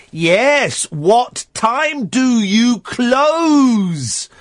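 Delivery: high, very slow, stretched-out English for foreigners; mock British tourist. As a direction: stretch every word as if to an idiot. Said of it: "the bloody idiot doesn't understand me".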